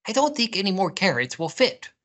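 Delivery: fast high squirrel; worried squirrel. A narrator's reading. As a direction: object hurriedly as a storybook squirrel. Squirrel objects to Rabbit.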